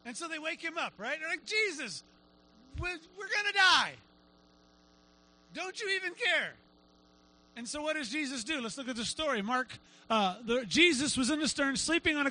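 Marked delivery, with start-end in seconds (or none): 1.4-6.5 high, stammering hammed-up alarm; mock panic. Disciples waking their sleeping teacher, mock-desperate. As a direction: plead high, as if about to die. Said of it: "they are freaking out".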